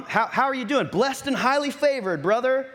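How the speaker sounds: church face. Acted. Churchgoer faking blessedness to a brother, put-on church greeting. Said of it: "our church face".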